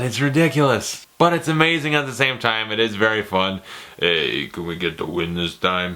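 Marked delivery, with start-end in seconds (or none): 4.0-5.9 low, soft action-hero mumble; film hero impression. Reviewer quoting a soldier hero's question, low mumbled impression. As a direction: drop low into a mock tough-guy voice. Acting out Rambo's line.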